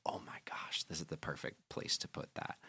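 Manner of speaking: hushed whisper of discovery; retold whisper, soft and unvoiced; whispered realization. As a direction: breathe it out like a secret eureka. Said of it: "I was like".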